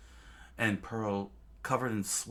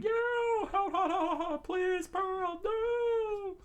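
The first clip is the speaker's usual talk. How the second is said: very high, squealed burning panic; thin high pleading scream; crew screaming; slower than usual. A narrator's reading. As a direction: squeal it as if on fire.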